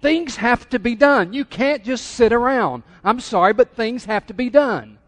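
high, emphatic acted protest; insistent Martha. Church's Marthas defending their busyness, insistent. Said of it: "We got some Marthas".

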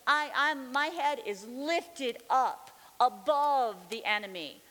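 loud, slow, emphatic proclamation; triumphant declaration. Retold or imitated. Believer repeating God's promise to her, triumphant. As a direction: declare victory, stretching the key words.

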